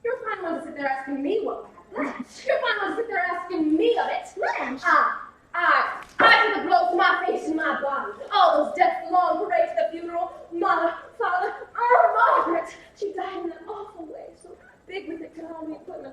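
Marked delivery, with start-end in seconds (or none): 2.5-4.3 louder, cutting accusation; bitter retort. Acted. Blanche snapping back at her questioning sister. 4.8-12.8 loud grief cracking into laughing sobs; anguished wail. Acted. Sister pouring out family deaths in loud anguish. Blanche breaks down recounting deaths.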